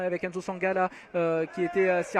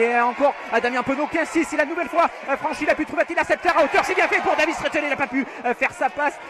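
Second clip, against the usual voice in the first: higher, louder live call; excited play-by-play; 6 semitones higher, 4 dB louder than usual. Real, not acted. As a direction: ride the break excitedly. Live attack, rising call.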